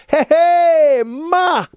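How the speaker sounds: put-on very high, loud toddler call. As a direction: squeal it like a one-year-old greeting mom. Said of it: "and he goes".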